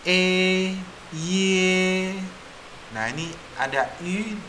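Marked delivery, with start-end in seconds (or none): 0.1-2.1 "E", then "ye", long and high on a level tone; model vowel sounds. Shown as a demonstration. Teacher modelling vowels, long high "E", "ye". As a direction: hold "E" and "ye" evenly.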